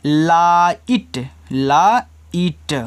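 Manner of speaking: लाइट stretched very slowly, twice; drawn-out word. Shown as a demonstration. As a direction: hold out लाइट long and clear for the kids. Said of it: "इसको लाइट पढ़ना है". (लाइट को खींचकर बोलना)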